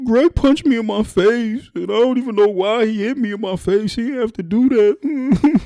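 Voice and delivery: put-on very high, whining crying child; tattling. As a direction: tell on him to mom in a teary wail. Crying boy tells on brother.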